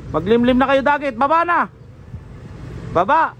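high-pitched call to the pigeon; calling a bird down. Real, not acted. Keeper urging pigeon Dagit down to nest, high-pitched calling.

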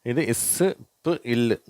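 இஸ், பு, இல்லு as separate sounds; teacher splitting the spl cluster; sounding out s, p, l. Shown as a demonstration. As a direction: break the cluster into its parts. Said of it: "மூணும் சேர்ந்த மாதிரி உச்சரிக்கணும்".

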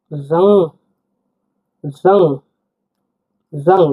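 জাং repeated clearly with pauses; teacher drilling জাং; word drill. Shown as a demonstration. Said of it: "তাহলে কি হলো".

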